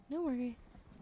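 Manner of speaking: usual speaking voice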